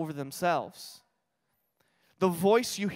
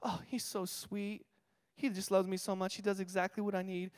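mock sweet wife; 8 dB quieter than usual.

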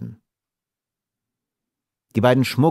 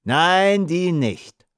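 higher-pitched mocking reply; amused jeer; 9 semitones higher, much slower than usual. A narrator's reading. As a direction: toss it back with a touch of amusement. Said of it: "lachten die beiden Bärtigen".

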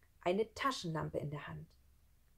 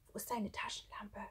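soft whispered question; hushed, breath-only asking; whispering; 3 semitones higher, 8 dB quieter than usual. Acted. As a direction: whisper to a friend in hiding. Boys hiding, whispering.

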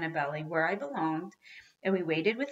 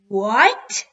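loud, higher shocked roar; 4 semitones higher, 13 dB louder than usual. A narrator's reading. Dad learning the toy went on the bus, outraged roar. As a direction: explode on the single word. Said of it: "roared my dad".

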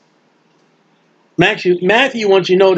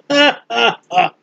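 mock laugh. Mock laugh after quote.

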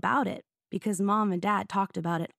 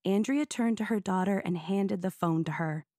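plain reading voice

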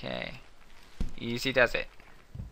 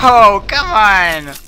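frustrated shout; 4 semitones higher, 8 dB louder, much slower than usual. Real, not acted. A failed jump in the game.

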